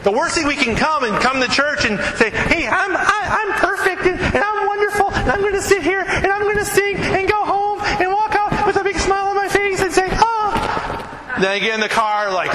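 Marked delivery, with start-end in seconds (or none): 2.3-11.3 much higher, sugary fake cheer; fake cheerful. Preacher mocking a churchgoer faking happiness, much higher and sugary. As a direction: pretend everything is perfect.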